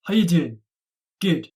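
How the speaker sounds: How you doing, "you" stressed, falling; Scottish How you doing. Shown as a demonstration. Tutor modelling the greeting again in Scottish. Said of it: "try saying it like me".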